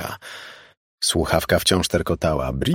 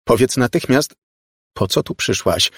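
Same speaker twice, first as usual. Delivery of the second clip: louder, insistent order; demanding; 5 semitones higher, 4 dB louder than usual. A narrator's reading. Margo orders the girl to explain herself, demanding. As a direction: demand an answer at once. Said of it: "zażądała Margo".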